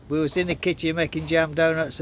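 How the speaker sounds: ordinary talk, nothing marked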